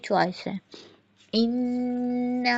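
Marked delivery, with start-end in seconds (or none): 1.3-2.6 इन्ना held long with nasal nn; ghunna demo. Shown as a demonstration. Teacher shows ghunna on इन्ना for students. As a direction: hum the nn of इन्ना slowly. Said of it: "नून मुशद्दद का गुन्ना proper".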